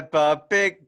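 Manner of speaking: high, loud cartoon British accent; Peppa Pig accent. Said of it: "Can you do your Peppa Pig accent?".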